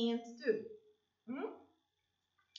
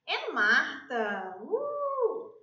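excited cheer; 6 dB louder, much slower than usual. Real, not acted. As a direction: cheer her name with a big woo. Excited cheer introducing Martha.

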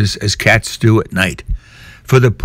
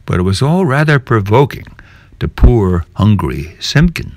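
usual speaking voice

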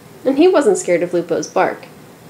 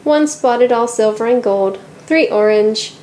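ordinary delivery

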